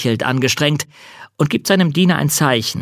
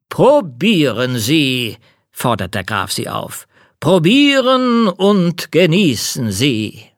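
count voice; 5 semitones higher, 3 dB louder, slower than usual. Acted. Count's voice, slow and higher.

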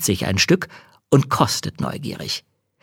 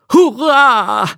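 high, loud cheer; 14 semitones higher, 6 dB louder than usual. Acted. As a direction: shout it out in joy. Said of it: "ruft der Pastor".

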